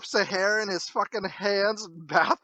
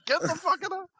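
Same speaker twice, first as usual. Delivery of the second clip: fast, higher mock rant; angry dad; 11 semitones higher, faster than usual. Dad scolding teens at dawn, fast higher mock rant. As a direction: rattle it off like a furious father. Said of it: "He's like".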